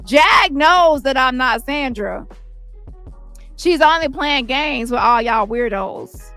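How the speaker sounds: ordinary talk, nothing marked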